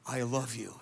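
low, soft, tender reassurance. Retold or imitated. The Lord to a confessing man, tender. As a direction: say it gently, hushed and low. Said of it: "to him tenderly".

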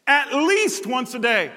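least stretched slow; stress on least. Real, not acted. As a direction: at least said slowly, weighty. Slow repeat for emphasis.

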